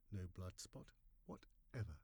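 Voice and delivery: ordinary delivery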